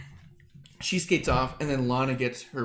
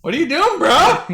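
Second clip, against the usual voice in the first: high, loud baffled shout; hammed-up bro outburst; mock exasperated yell; 13 semitones higher, 10 dB louder than usual. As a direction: yell at a partner who blew the spot. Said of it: "She's like".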